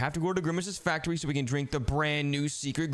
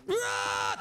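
startled scream. Acted. Scare entering the room.

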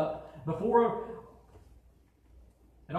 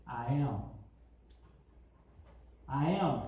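low divine voice; 4 semitones lower, 3 dB quieter than usual. Acted. God speaks to Moses.

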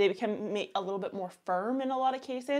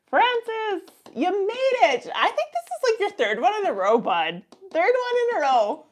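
excited, loud Canadian greeting, pitched high; high, hollered Canadian accent, thrilled; 11 semitones higher, 8 dB louder than usual. Real, not acted. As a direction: cheer them on in a strong Canadian accent. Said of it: "That sounded very Canadian".

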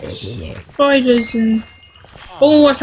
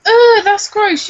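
disgusted; 6 semitones higher, 5 dB louder, slower than usual. Real, not acted. Reacting to zombie flesh.

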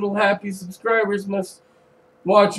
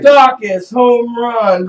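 excited; 3 semitones higher, 8 dB louder, much slower than usual. Real, not acted. Calling out ballgame home run.

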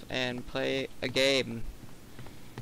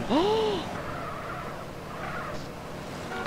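gasp; 4 dB quieter than usual. Real, not acted. Sees car crashes.

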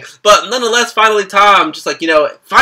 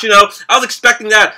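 ordinary talk, nothing marked

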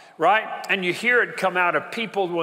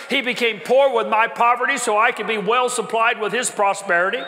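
raised, insistent preacher's voice, building louder; fired-up preaching; 5 semitones higher, 4 dB louder than usual. Real, not acted. Preacher to congregation on having no reason for anger, fired-up preaching. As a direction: preach it with mounting force, line upon line.

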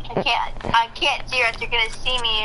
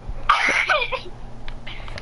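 breathy high laugh; 13 semitones higher than usual. Real, not acted. Laughs at kangaroo joke.

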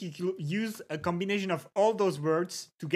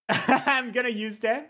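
higher, laughing as the words come out; 4 semitones higher, 3 dB louder than usual. Real, not acted. Streamer tells chat about using new Dutch words, laughing. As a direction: say it through a laugh.

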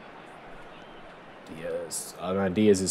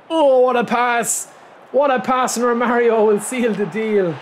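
high, loud cry of delight at the pass; excited commentary; 12 semitones higher, 5 dB louder, slower than usual. Real, not acted. Gamer telling viewers about his goal, excited yell. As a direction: call it like a thrilled commentator.

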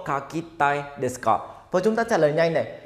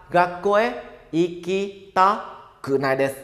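slow model reading; slower than usual. Shown as a demonstration. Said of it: "mình phải phủ định cái tai nó đi".

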